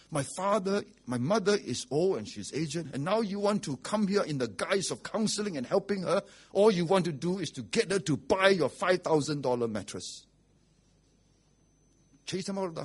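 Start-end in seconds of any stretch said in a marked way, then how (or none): 0.1-10.3 indignant retold confrontation, louder; forceful, heated accusation; angry son. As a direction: imitate a son accusing the salespeople. Acts out angry son confronting them.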